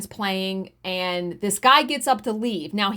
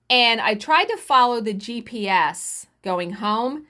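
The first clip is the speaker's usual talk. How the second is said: ordinary talk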